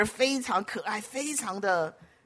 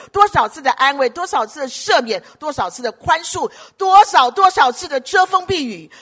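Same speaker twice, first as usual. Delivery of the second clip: 越讲越响的讲道，一句接一句; 全力向会众呼喊着讲道; 激昂讲道; 5 semitones higher, 9 dB louder than usual. Acted. Preaches about remembering God's goodness. (牧师向会众大声激昂地讲道)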